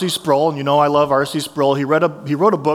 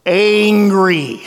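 blunt one-word answer, a touch louder; deadpan Farley angry; 3 semitones higher, 4 dB louder than usual. Retold or imitated. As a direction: sum it all up in one grumpy word. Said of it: "And he just goes".

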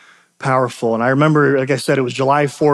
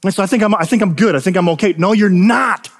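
higher, emphatic sermon delivery; impassioned preaching; 6 semitones higher than usual. Real, not acted. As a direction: preach it with conviction, leaning on key words. Preaches the point.

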